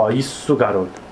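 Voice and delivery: deep self-encouraging coach; low, steady pep talk to himself. Pretend. Praising his character.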